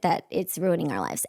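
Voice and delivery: ordinary talk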